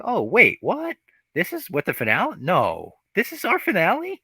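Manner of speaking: high, incredulous squeak of disbelief; mock shock. She-Hulk reacting to the finale twist, squeaking in mock disbelief. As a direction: ham up her baffled shock at the finale.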